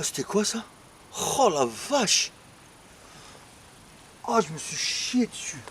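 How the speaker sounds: breathless and rattled; shaken. Real, not acted. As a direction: still reeling from the scare. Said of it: "laissez-moi me remettre".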